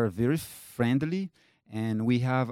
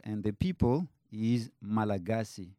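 ordinary delivery

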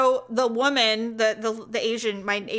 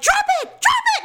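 much higher, louder commanding yell; yelling at the dog; 6 dB louder than usual. Retold or imitated. Owner reenacts ordering her dog to drop the bird, shouting. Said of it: "And I'm like".

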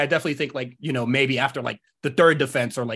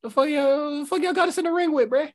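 mock outraged wrestler; 14 semitones higher than usual. Acted outrage, much higher.

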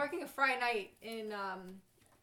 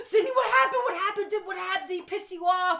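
much higher, louder, panicked questions; breathless coworker; 8 semitones higher, 10 dB louder than usual. Retold or imitated. Coworker running over asking Sydney, higher and louder. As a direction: rush in gasping from laughter. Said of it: "She's like, gasps".

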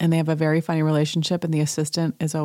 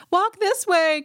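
high, eager assistant voice calling; mock assistant; slower than usual. Assistant calling out to Sutton, high and eager. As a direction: chirp it up high like an excited helper. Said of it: "he's like".

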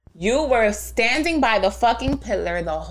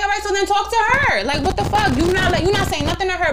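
much higher, heated mock retort; exasperated retort; 11 semitones higher, 3 dB louder than usual. Retelling snapping at the manager, high indignant retort. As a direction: throw it back at him, high and indignant.

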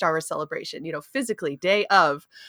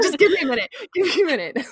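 laughing; 8 semitones higher, much faster than usual. Retold or imitated. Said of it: "Just like".

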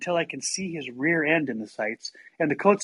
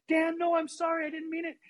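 high-pitched desperate begging; mock-panicked whine to calm him down; pleading apology; 12 semitones higher than usual. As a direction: imitate a kid frantically apologizing. Begging the screaming brother for forgiveness.

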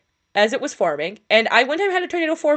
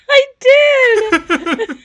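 laughing shout; 14 semitones higher, 11 dB louder than usual. Real, not acted. Laughing protest to friend.

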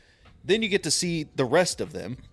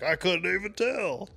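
higher, croaky mock guy voice; froggy voice; 6 semitones higher than usual. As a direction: croak it like the contestant. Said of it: "a little froggy".

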